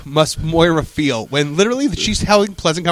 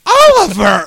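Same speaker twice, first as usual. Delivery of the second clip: calling out; 8 dB louder, slower than usual. Retold or imitated. Calling out name.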